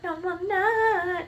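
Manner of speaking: put-on high, slow childish protest; drawn-out mock child denial; imitating defiant child. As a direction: whine it back high, mimicking a sleepy kid. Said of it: "she's saying back to me".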